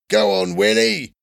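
high cheer from the crowd; shouting. A narrator's reading. Another boy backs Willie in the fight, shouting. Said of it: "shouted another".

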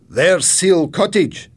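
loud, high and drawn-out; sudden announcement. A narrator's reading. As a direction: call it out as a sudden sighting. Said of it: "Mister MacDonald said suddenly".

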